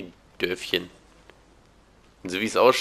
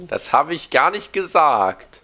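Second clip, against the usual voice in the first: slow, louder, higher protest; empörter Protest; 6 semitones higher, 8 dB louder, slower than usual. Real, not acted. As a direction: stretch out the indignant denial. Protests being misquoted. (gedehnt und empört)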